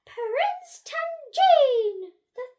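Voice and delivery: high, booming ceremonial introduction; flustered head teacher announcing. A narrator's reading. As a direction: build to a huge drawn-out fanfare on the name.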